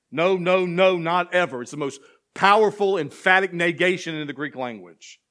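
fervent sermon cadence, climbing in pitch; full-force preaching. Acted. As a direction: preach the psalm out to the back row. Emphatic negation explained.

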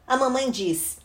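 ordinary delivery, nothing marked

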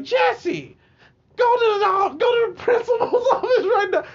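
shrieking high, loud mock order; scolding lady teacher. Teacher sending Jesse to the principal, high outraged shriek. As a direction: imitate an outraged teacher in piercing falsetto.

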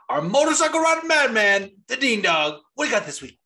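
loud, high hype-man announcer; mock announcer building up a caller; Rhino's show intro. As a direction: open with a big holler, then ease down. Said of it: "he'll be like".

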